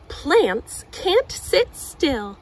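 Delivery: slow, deliberate title reading; title read slowly. Acted. Reading the book title to a child, slow.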